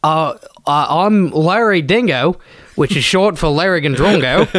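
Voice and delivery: slow mock-Aussie introduction; put-on Australian bloke; Australian accent. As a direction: introduce yourself as a broad Aussie. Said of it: "doing Australian accents".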